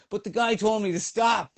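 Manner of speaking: a hint of a high shouted protest; mock shouted reply. Canadian runner shouting back uphill, mild mock yell. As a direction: call back, confused. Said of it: "shouts back at me".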